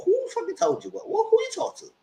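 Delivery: very high demanding falsetto; mock suspicious wife. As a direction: grill him in a squeaky voice. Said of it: "She said".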